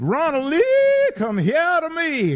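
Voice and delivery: high, slow sing-song call; house mother calling. Retold or imitated. House mother calling the boy out of kindergarten, high sing-song. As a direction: call the boy's full name out.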